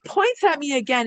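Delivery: usual speaking voice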